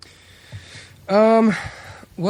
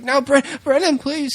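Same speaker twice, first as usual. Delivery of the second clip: desperate plea; 12 semitones higher than usual. Retold or imitated. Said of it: "and he's like".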